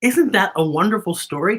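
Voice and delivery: ordinary delivery, nothing marked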